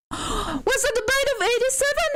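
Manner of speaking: high, gasping shocked question; excited gasp. Retold or imitated. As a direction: gasp then squeal the question. Markiplier meme reenactment.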